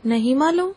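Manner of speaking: نہیں معلوم thrown back as a question, rising at the end; questioning echo. Acted. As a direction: echo نہیں معلوم with a climbing, challenging tune. Echoes his answer back as question. (نہیں معلوم کو سوالیہ لہجے میں دہرانا)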